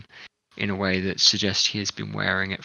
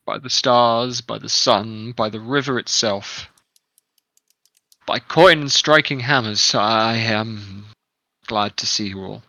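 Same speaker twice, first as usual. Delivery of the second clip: lightly voiced old man, effusive; old man swearing thanks by moon and stars; grateful old man; 4 dB louder than usual.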